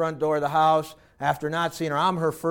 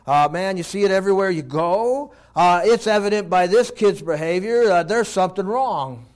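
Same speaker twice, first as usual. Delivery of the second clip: ordinary delivery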